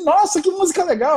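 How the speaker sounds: very high, delighted wife voice; excited wife. Retold or imitated. Wife on the dance floor gushes about the music, very high excited voice. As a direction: she loves the music.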